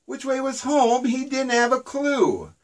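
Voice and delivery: ordinary delivery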